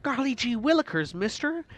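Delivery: put-on high, eager golly-gee kid voice; 1960s youngster. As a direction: play a wholesome old-timey kid. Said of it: "I sound like a nineteen sixties, like, youngster".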